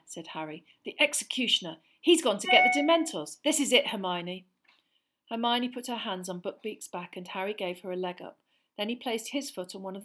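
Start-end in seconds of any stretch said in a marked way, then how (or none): 0.8-4.5 high, loud and urgent; alarmed urgency. Acted. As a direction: sound the alarm in a rush. Harry alarmed, Dementors coming.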